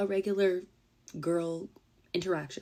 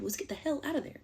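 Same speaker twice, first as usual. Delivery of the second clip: normal talking voice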